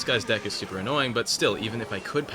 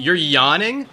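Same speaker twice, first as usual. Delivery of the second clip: real indignant disbelief, high and loud; loud, offended incredulity; outraged; 7 semitones higher, 9 dB louder, slower than usual. Real, not acted. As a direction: fire it back as an outraged question. Opponent taunts with yawn emote.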